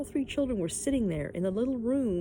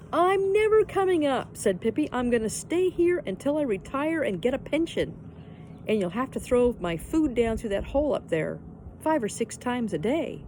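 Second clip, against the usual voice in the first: Pippi teasing from the hole; 5 semitones higher, 4 dB louder than usual. A narrator's reading. Pippi teases from hollow tree.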